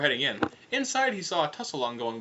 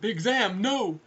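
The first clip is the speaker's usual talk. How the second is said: high cry of protest; alarmed call; 8 semitones higher, 3 dB louder, much slower than usual. Acted. Foyle seeing Big Zam lunge at the alien, alarmed cry. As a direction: call out high to stop a friend. Foyle tries to stop attack.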